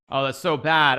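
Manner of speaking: loud, exasperated self-reproach; disgusted with himself; loud groan of dismay. Real, not acted. Groaning at own blunder.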